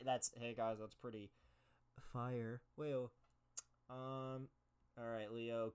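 ordinary talk, nothing marked

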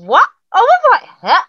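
high, loud disbelieving what; high, loud stunned huh; shocked. Retold or imitated. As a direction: squeal of surprise at what she heard. Excited retelling of surprise.

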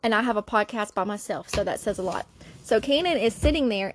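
ordinary talk

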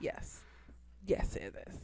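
whispered, barely any voice; a tiny hushed confirmation; soft whispered aside to co-host. Real, not acted. Whispered agreement after laugh.

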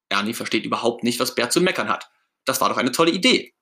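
plain reading voice

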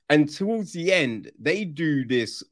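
ordinary delivery

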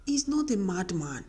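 normal talking voice

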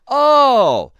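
long, high, loud oh, repeated for emphasis; drawn-out oh. Retold or imitated. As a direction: stretch the oh so it sinks in. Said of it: "Let me let that thing sink in".

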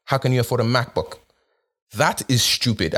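ordinary talk, nothing marked

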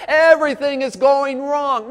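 high, loud and slow, everything is going wrong; exaggerated despair, drawn out; overdramatic wail. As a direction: wail it like the world is ending. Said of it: "this terrible emotional trait".

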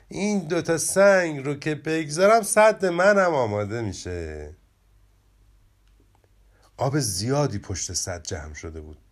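lively children's story reading, characters lightly voiced; expressive storybook narration with playful character voices. A narrator's reading. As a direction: tell it like a bedtime tale for kids. Reads the story aloud.

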